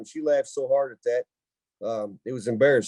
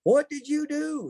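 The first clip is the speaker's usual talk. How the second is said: high, drawn-out mock question; hammed-up high aunt voice; imitating his aunt; 12 semitones higher, slower than usual. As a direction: voice an astonished aunt, high and slow. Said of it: "she goes".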